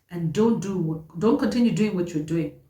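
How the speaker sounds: usual speaking voice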